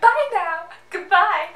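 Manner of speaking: high, loud princess farewell; cartoon princess voice. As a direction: chirp it like a princess. Copying sister's princess goodbye.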